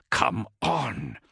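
urging through gritted teeth. A narrator's reading. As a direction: force it out through clenched teeth. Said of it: "he urged through gritted teeth".

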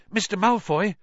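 a touch of a gasp. A narrator's reading. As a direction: call out as if catching your breath. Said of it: "he gasped".

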